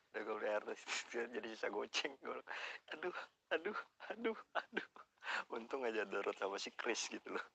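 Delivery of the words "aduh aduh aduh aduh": mock panic. Panicking over running out of cash.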